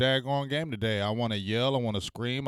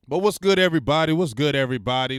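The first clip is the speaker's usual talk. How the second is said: loud greeting; 4 semitones higher, 9 dB louder than usual. Real, not acted.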